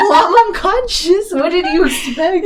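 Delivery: high, laughing mock indignation; exasperated retort. Unconscious pal answers drunk friend, high exasperated protest. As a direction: protest as if it's absurdly obvious.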